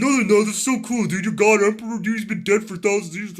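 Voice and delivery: overexcited fan who can't contain himself; drooling fanboy gush. Retold or imitated. Mocking the Chaos fan at the counter, gushing wildly. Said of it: "Dude, stop drooling. Like, calm down".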